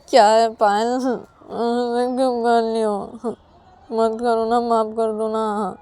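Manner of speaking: slow, drawn-out begging; whining plea. Acted. Ananya begs Payal to forgive her, whining. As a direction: beg with a plaintive whine.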